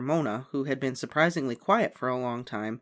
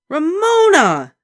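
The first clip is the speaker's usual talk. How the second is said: high, loud, slow drawn-out cry of the name; horrified shout; 14 dB louder, much slower than usual. A narrator's reading. Big sister horrified at Ramona, shout. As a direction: shout her name in horror.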